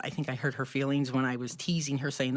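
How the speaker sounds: ordinary delivery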